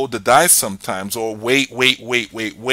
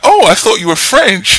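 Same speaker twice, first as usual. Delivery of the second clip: louder, higher mock surprised voice; imitating people; 12 semitones higher, 9 dB louder than usual. People think he's French, mock surprise, higher and louder. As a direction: pop up high and loud like a surprised stranger. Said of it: "some people are like".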